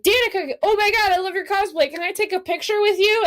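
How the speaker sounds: high, eager fangirl impression; gushing fan. Fan stopping a cosplayer for a photo, gushing.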